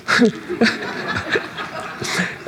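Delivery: embarrassed airy laugh; soft breathy chuckle. Real, not acted. Preacher laughing at his own dance. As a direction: laugh at yourself under your breath.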